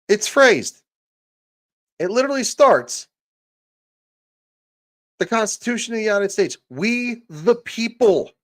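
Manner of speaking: high and loud, driving the point home; fired-up rant. Real, not acted. Streamer lecturing chat on the Constitution, high and loud. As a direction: hammer it home, worked up and loud.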